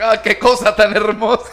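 commentator gush. Retold or imitated. Mocking commentator after goal.

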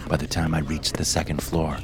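plain reading voice

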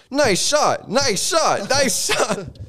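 game announcer. Retold or imitated. Imitating game voice.

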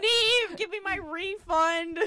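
high, loud, slow demand; angry demand. Retold or imitated. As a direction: demand the refund loudly.

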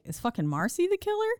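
high, shocked realisation; shocked question. Retold or imitated. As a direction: gasp it out in disbelief. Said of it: "I was like".

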